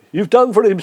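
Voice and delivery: ordinary talk, nothing marked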